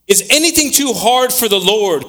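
high, ringing rhetorical question; preaching scripture. Acted. Preacher putting the Lord's question to the congregation, high preaching voice. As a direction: pitch it up and proclaim it to the room.

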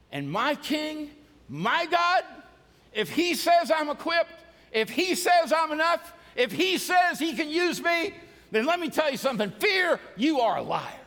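high, loud, rising proclamation; full-force preaching. Acted. Preacher rallying the congregation against fear. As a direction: declare it over the crowd.

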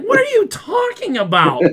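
mock baffled question; incredulous. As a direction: fire it back in shocked disbelief. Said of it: "I'm like".

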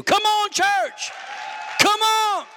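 high, slow, drawn-out call to the congregation; preacher's rousing high call of come on church. Real, not acted.

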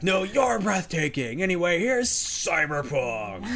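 high, loud, slow mock stage shout; hammed-up showman's holler; imitated yell back. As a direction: throw the compliment back, stretched and booming. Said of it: "Keanu yells back".

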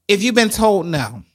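normal talking voice

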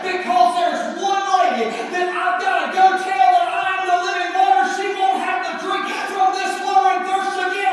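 loud, driving sermon delivery, often shouted; full-force preaching. Acted. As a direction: preach it out to the whole church.

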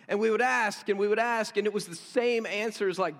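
ordinary talk, nothing marked

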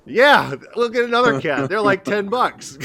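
high, loud, breezy put-on voice, slowing at the end; mock casual shrug. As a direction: toss it off as if a cat costs nothing. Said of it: "We're like".

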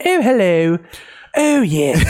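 high, hammed-up British greeting; mock British accent. Showing the one British accent Americans do, mock British. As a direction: put on a silly high British voice. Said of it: "we have the one British accent".

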